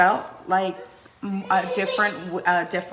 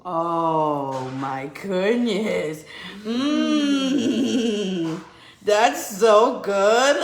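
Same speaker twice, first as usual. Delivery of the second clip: savoring; much slower than usual. Pretend. Savoring food for the baby.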